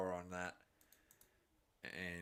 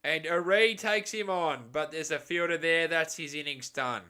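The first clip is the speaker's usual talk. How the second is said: loud, higher call that drops as the catch is taken; excited call; 6 semitones higher, 9 dB louder than usual. Real, not acted. Commentator calling Aree's shot to a waiting fielder, excited then let down. Big shot caught at boundary.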